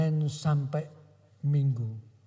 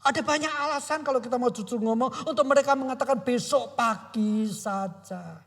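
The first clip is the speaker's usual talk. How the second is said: high, loud preaching with a mock excuse inside; impassioned preaching; 10 semitones higher, 4 dB louder than usual. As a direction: preach the disciples' grumbling excuse. Preaching continues at full force.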